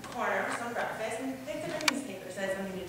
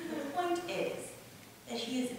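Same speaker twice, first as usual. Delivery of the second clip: ordinary talk, nothing marked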